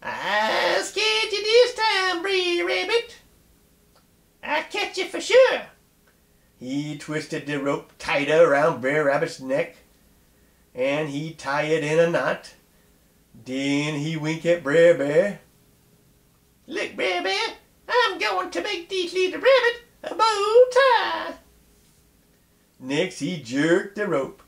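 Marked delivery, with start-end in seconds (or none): 0.0-5.6 high snarling fox voice; a sly fox gloating over his trapped prey. Acted. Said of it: "and snarled his teeth". 16.7-21.3 high, gleeful fox voice with a laugh; gloating fox. Acted. Fox gloats to bear over rabbit.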